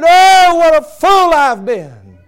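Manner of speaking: high, loud, slow wail of regret; preacher echoing the dying father's scream; screaming in despair. Retold or imitated. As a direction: cry it out like a damned man.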